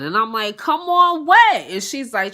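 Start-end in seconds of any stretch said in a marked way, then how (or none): 0.6-1.6 high, loud, drawn-out comeback; indignant retort. Retold or imitated. Officer retelling her answer to the supervisor, indignant retort. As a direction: throw it back, loud and high.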